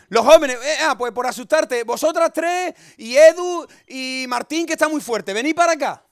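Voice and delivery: loud playful call to come up. Real, not acted. Calling youths forward.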